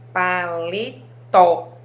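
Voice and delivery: very slow, loud "paletó" split as pa-le-tó; stress on final syllable. Shown as a demonstration. Teacher demonstrating syllable separation, "paletó" stressed on "tó". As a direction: spell out "paletó" by syllables, hitting "tó" hardest. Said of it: "é o que eu pronunciei com mais intensidade".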